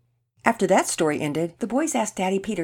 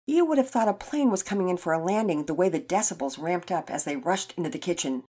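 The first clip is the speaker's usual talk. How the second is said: ordinary delivery, nothing marked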